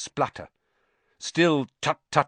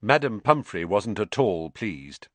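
ordinary delivery

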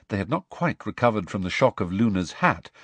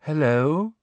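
higher, airy dreamy greeting; vague, dreamy; 6 semitones higher than usual. A narrator's reading. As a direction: drift in with a faraway hello. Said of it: "said a vague and dreamy voice".

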